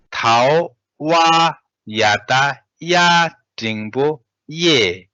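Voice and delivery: teacher spelling Tibetan syllables clearly; Tibetan syllables read aloud. Shown as a demonstration. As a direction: spell each Tibetan syllable out loudly, one by one. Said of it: "And then we have".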